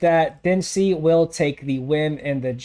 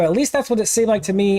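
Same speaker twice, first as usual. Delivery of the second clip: ordinary delivery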